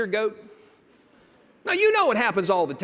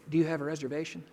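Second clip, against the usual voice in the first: desk clerk quote; 9 semitones lower, 9 dB quieter, faster than usual. Retold or imitated. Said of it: "she said this to me".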